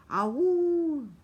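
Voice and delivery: high loud howl in the dark; far-off night cry, high and loud. A narrator's reading. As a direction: let a long awoo ring out. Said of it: "a cry went out".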